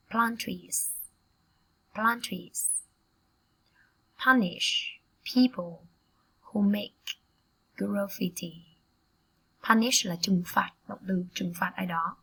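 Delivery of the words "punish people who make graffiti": slow phrase broken by clear pauses; slow, word by word. Shown as a demonstration. Teacher introduces a phrase to students, slowly word by word. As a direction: dictate it in short separate pieces.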